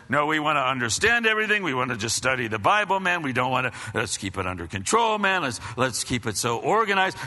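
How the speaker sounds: low, hurried voice of a stiff congregation; fast, low-pitched mock caution; controlled church member. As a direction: mimic a church keen on keeping control. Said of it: "you've got the church that".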